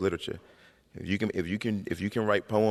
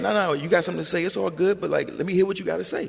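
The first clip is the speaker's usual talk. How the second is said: mother's higher, louder, quick urging; coaxing mother; 11 semitones higher, 4 dB louder than usual. Retold or imitated. Mother to son who holds back, coaxing. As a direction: imitate mom pressing for an answer. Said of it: "And she'd be like".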